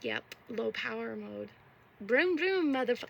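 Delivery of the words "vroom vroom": engine noise. Pretend. Imitates engine.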